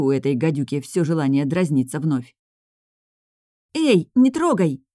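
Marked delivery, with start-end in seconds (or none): 3.8-4.8 high, loud, drawn-out warning; fierce squeal. A narrator's reading. Girl guards sister's apron, squealing fiercely. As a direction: squeal it ferociously to stop a thief. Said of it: "свирепо пропищала я".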